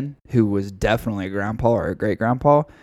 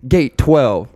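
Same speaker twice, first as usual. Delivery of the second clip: louder, higher hype-man announcement; hyped fight call; 5 semitones higher, 8 dB louder, slower than usual. Pretend. Host joining the mock showdown, loud hype. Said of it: "I wish you'd be like".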